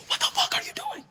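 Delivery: high shocked yell at him; shocked scream. Retold or imitated. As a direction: scream it out, stunned and disgusted. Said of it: "I was like".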